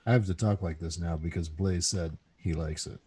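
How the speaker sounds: low, smooth announcer delivery; radio announcer voice. Acted. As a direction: play the radio host. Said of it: "The radio announcer voice".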